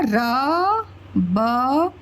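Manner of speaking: letters spaced out very slowly; slow spelling. Shown as a demonstration. Teacher joining र and ब into a word, slowly. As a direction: lay each letter down slowly and deliberately. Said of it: "जब आप शब्द निर्माण करते हो".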